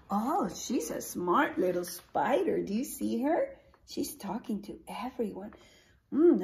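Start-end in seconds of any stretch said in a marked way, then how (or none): none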